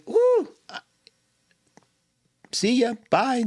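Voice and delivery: high whoop, then a quick breezy bye; gleeful goodbye. Retold or imitated. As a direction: shut the door on the world with delight. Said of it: "it's like".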